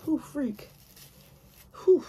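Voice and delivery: ordinary delivery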